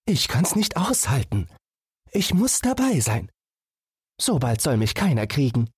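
small animal voice, longing to run out; eager little fawn. Acted. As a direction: beg like a restless young deer. Fawn insists on hunt.

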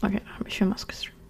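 rushed whispered admission; fast whisper. Real, not acted. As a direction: mumble it fast under breath. Quick whispered concession.

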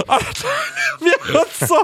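high laughing shout; real laughter breaking through. Real, not acted. Bursts out laughing at own story.

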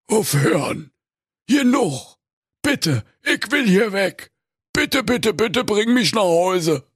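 high-pitched, desperate begging; anguished pleading. A narrator's reading. Old miser begs the ghost to go home, anguished. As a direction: beg to be taken away. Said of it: "so klamm ums Herze".

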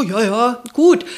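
casual upbeat answer, last word loud; breezy friend. Retold or imitated. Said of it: "rief dann an und meinte". (lockere, laute Freundin am Telefon)

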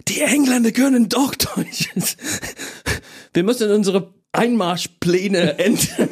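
high-pitched, aghast German wife, played for laughs; mock stunned disbelief. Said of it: "Und sie war fassungslos".

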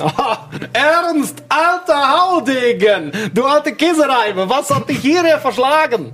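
high, hearty welcoming voice; jovial pilot voice. Acted. Juri greets old friend.

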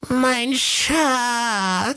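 very high, hissing creature whisper of the bath attendant; Gollum voice. A narrator's reading. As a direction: croak it out like Gollum, longing. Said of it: "rief er nur ganz leise". (ganz leise, mit hoher Gollum-Stimme)

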